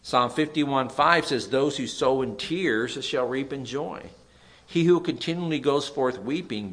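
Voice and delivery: preacher reading scripture aloud; preaching. Acted. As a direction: proclaim the verse to the congregation. Reads scripture with preaching force.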